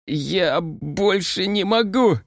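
high drawn-out cry of pain; pained wail. A narrator's reading. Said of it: "Май завопила от пронзающей разум боли". (вопль от боли, высоко и протяжно)

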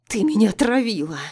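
loud pained accusation; accusing cry. A narrator's reading. Empress accuses narrator. (обвиняющий крик)

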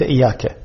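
إياك as a light "iyaka"; no shadda. Shown as a demonstration. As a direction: say إياك with a single short y. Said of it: "وليس". (إياك بياء مخففة)

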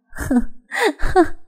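short high scornful laugh; sharp mocking laugh; bitter laugh. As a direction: laugh at him in disbelief. Bitter laugh at his claim.

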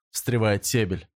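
ordinary delivery

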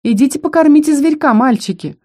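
high-pitched malicious taunt; spiteful, crowing mockery; gloating. A narrator's reading. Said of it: "продолжала злорадствовать Лейка".

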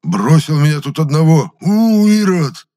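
high, loud, strained cry of betrayal; hoarse outrage. A narrator's reading. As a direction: build to a long howled curse. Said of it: "прохрипел Митрофан".

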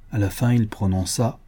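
ordinary delivery, nothing marked

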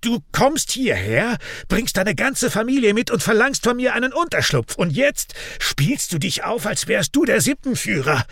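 high-pitched, outraged tirade; angry shout. A narrator's reading. Raik in his brother's face, shouting angrily. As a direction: yell accusations, pitched high. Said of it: "schreit Raik ihm ins Gesicht".

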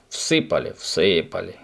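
mock the words back crossly; angry mocking echo. A narrator's reading. Papa sourly echoes Subastik's boast, angry mocking. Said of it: "сердито передразнил его тот".

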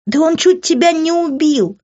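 high, loud outraged cry; distressed exclamation. A narrator's reading. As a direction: cry out in distress, pitched right up. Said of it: "воскликнула она, и её голос дрогнул". (отчаянное восклицание)

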